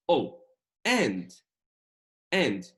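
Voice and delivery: "أو" and And punched loud, with a falling tune. Shown as a demonstration. Teacher showing a sudden idea, And said loud and emphatic. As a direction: hit And hard, as if an idea just struck. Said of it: "نبرة عليت لأن أنا I just started to realize something".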